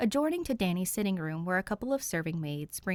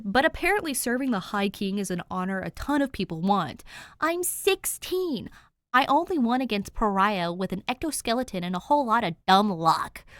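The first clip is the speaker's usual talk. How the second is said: ranting; 4 semitones higher, 7 dB louder than usual. Acted. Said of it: "He pauses his rant".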